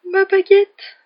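high, loud, distressed wail; trembling voice. A narrator's reading. Ron finding his wand snapped, voice trembling. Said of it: "répondit Ron d'une voix tremblante".

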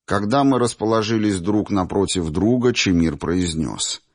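ordinary delivery

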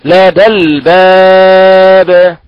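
الباب recited slowly with a light, thin ب; tajweed recitation. Shown as a demonstration. Teacher reciting the example لدى الباب slowly for students. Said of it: "مثالها".

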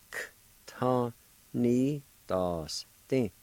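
"K, ta, ni, das, din" with every sound set apart; syllable by syllable. Shown as a demonstration. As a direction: sound out "K, ta, ni, das, din" piece by piece, K without a vowel. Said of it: "pronounce the K with the T A".

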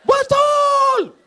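high, loud yelp of agreement; sudden shout. Acted. Preacher baiting the crowd with a high, loud shout. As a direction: blurt it out high and loud like a cheer.

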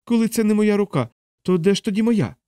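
high-pitched desperate challenge; heated. Acted. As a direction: argue hotly, pitch way up. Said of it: "запально вигукнув капелан".

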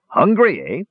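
man's voice. A narrator's reading. Man taunting the squirrel.

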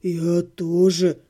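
slow, low groan; low drawn-out groan; moaning. A narrator's reading. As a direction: moan it in misery. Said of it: "простонал Отто".

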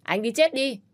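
loud, high angry yell; shout. Acted. Woman splashing water at the rude man, shouting angrily. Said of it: "Tôi hét lên".

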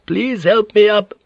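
put-on high, slow, frail old lady; old woman. A narrator's reading. Old woman asks her maid for help, slow frail plea. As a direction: ask slowly and weakly, high-voiced. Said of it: "The old woman said to the maid".

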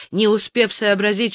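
ordinary delivery

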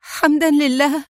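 high, tearful cry of thanks; overjoyed cry. A narrator's reading. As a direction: burst out in great joy. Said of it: "وهتفت في فرح شديد".

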